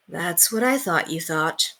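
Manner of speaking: gentle and knowing. A narrator's reading.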